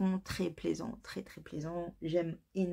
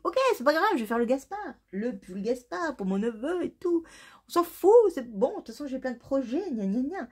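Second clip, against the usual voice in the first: self-talk quote; 6 semitones higher, 4 dB louder than usual. Retold or imitated. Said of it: "je me suis dit".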